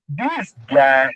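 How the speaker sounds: ordinary talk, nothing marked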